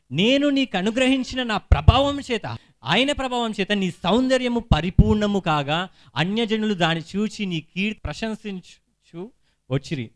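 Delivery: slow, loud proclamation of the verse; emphatic scripture reading. Acted. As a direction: read it out slowly like a forceful preacher. Reads scripture out forcefully.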